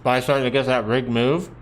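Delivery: talking through a mouthful of food; chewing while speaking; mouth full. Real, not acted. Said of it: "I'm talking with food in my mouth".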